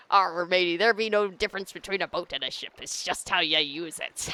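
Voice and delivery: put-on weird pirate accent. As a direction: play a goofy pirate accent. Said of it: "Some weird pirate accent".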